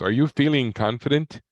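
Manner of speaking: high, loud, bright phone-survey voice; chirpy voice. Retold or imitated. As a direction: chirp it like a cheery telemarketer. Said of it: "some chirpy voice says".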